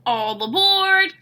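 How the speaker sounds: calling out. A narrator's reading. Bear calls his friend aboard, loud and drawn out. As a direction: call everyone aboard, slow and bright. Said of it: "calls Bear".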